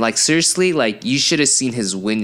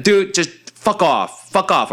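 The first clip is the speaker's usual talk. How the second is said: higher-pitched, irritated brush-off; fed-up retold yell; 8 semitones higher than usual. Drunk victim sending the worried driver away, irritated mild yell. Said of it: "I had to yell at one, at one point".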